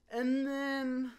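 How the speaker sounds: high, held suspenseful lead-in; dramatic build-up. Real, not acted. Storyteller sets up the next twist, high and suspenseful. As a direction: lift it high and let it hang.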